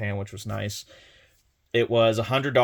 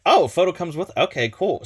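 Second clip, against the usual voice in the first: higher, louder pleased surprise; mock surprise; 7 semitones higher, 4 dB louder than usual. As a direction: act pleasantly caught off guard. His surprised reply, retold.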